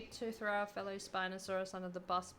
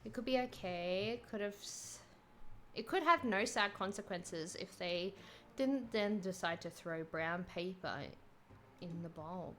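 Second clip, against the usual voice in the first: normal talking voice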